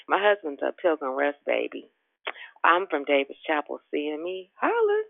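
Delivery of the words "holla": high, whooped holla; excited holla. Real, not acted. Calling out to fellow church members, a high excited holla. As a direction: shout out your home church with a whoop. Shout-out to her home church.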